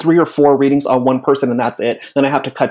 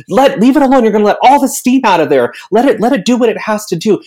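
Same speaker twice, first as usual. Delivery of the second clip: higher, louder mock scolding; exasperated kitchen nag, lightly acted; insistent telling-off; 6 semitones higher, 4 dB louder than usual. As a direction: raise pitch and volume, urging someone to leave it be. Acted scolding of impatient rice cook.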